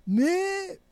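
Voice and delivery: Mais jumped high and loud; stressed mais. Shown as a demonstration. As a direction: hit the word Mais hard and high. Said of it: "le mais". (un Mais appuyé très aigu)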